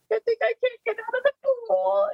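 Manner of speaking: very high, squeaky mock crying; imitating your own helpless wail; retold tearful wail. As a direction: whimper it in an exaggerated high voice. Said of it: "then I started crying".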